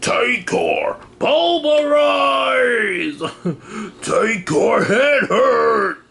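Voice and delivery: high, slow dim brute shouting; Tankor voice. Retold or imitated. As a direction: bellow it like a dumb robot brute. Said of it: "Tankor from Beast Machines".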